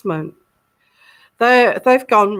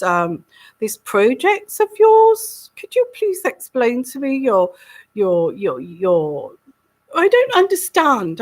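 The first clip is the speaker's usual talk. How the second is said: the Queen. Acted. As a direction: ask politely in a mock royal voice. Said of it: "I'll try again, okay".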